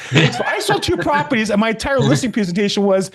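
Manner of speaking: ordinary talk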